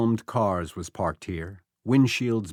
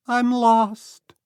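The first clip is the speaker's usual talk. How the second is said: put-on lost boy calling; 13 semitones higher, 6 dB louder than usual. A narrator's reading.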